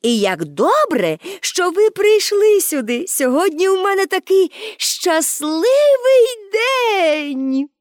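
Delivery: high, drawn-out joyful exclamation; exclaiming. A narrator's reading. Pippi welcomes visitors on her happy day, exclaiming. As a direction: build to a slow, ringing cry of happiness. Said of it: "вигукнула Пепі".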